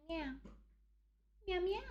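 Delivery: high soft cat meows; meowing at cat. Pretend. Cooing meows at her cat. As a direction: talk to the pet like a kitten.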